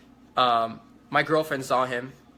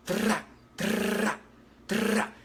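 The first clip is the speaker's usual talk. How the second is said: high trilled rattle, three short bursts; imitated gunfire bursts; 7 semitones higher, much slower than usual. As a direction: roll the tongue like automatic fire. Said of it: "They were like".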